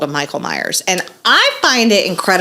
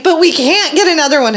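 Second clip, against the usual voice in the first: high mock tearful protest; whining child; 10 semitones higher, 4 dB louder than usual. Mom imitating child objecting to a replacement, whiny. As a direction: wail it like an inconsolable kid. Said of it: "And she's like".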